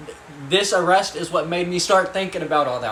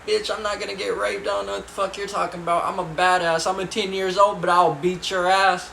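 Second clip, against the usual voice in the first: put-on cocky kid; 6 semitones higher than usual. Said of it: "I was like".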